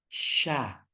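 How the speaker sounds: "Syah" with the mouth opened wide like a blooming rose; slow, open demo of "Syah". Shown as a demonstration. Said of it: "mekar, bagaikan bunga mawar".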